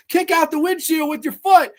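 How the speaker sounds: high, loud mock order; hammed-up urgent command; Seth Rogen impression. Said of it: "fucking Seth Rogen goes".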